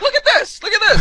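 very high, insistent repetition; mock excited urging. Actor flagging down con staff, mock excited. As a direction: imitate someone eagerly grabbing passers-by's attention.

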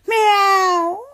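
a coy little kitten meow; cat meow. Acted. Cat girl meowing.

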